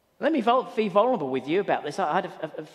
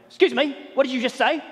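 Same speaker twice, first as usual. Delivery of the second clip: mildly indignant retort, louder and higher; provoked, indignant; 4 semitones higher, 5 dB louder than usual. Retold or imitated. Staff member challenging a sarcastic remark, indignant. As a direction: act out an offended challenge. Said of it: "provoked something".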